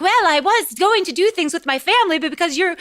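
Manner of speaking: put-on boy eagerly agreeing after the money. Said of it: "the boy's like".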